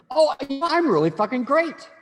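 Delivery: high, loud, exuberant outburst; over-the-top elation. Shown as a demonstration. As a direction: blast it out as if wildly thrilled. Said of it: "that, that's scary".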